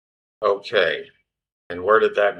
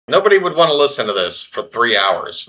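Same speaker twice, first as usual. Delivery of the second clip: ordinary talk, nothing marked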